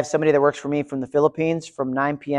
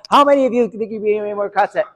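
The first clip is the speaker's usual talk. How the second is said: hurried, higher-pitched repeat of a question; rushed repeat; 5 semitones higher than usual. Shown as a demonstration. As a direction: rattle the question off fast, pitched up. Repeats question once hands are raised.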